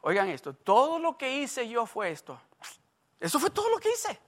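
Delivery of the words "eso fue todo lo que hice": put-on higher, quick, innocent little-boy protest; mock-innocent child's voice. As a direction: play the cheeky boy who did nothing. Imitating his young self.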